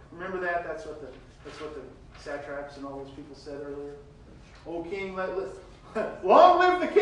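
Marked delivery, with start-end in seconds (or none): 4.7-7.0 high, loud, laughing mock praise; hammed-up sarcastic salute to the king. Daniel mocking the king with a laugh, sarcastic. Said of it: "Maybe there was some sarcasm there".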